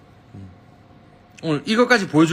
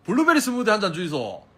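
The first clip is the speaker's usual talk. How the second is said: put-on old-fashioned Busan dialect order, pitched up; old Gyeongsang uncle speech; 7 semitones higher than usual. Shown as a demonstration. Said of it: "옛날 우리 삼촌들이 쓰던 말투".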